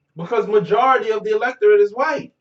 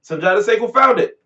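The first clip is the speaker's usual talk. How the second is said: ordinary talk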